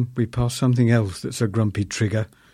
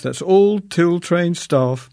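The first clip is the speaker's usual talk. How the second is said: slow, drawn-out announcer repeat, raised and louder; slow tannoy repeat; 6 semitones higher, 4 dB louder, much slower than usual. Retold or imitated. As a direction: repeat it slowly like a store tannoy.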